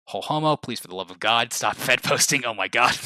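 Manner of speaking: amused pleading, breaking into a laugh; laughing exasperation. Real, not acted. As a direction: beg them to stop while laughing. Laughing plea to a chatter.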